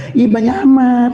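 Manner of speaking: a hint of a surprised wife; wife's exclaim. Wife reacting to ten meatballs, lightly mock-startled. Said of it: "kata istri".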